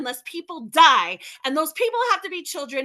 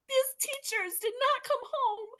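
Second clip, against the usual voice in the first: slow, higher, softer, voice close to breaking; getting emotional; 8 semitones higher, 5 dB quieter than usual. Real, not acted. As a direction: hold back tears on the words. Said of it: "I don't apologize for getting emotional".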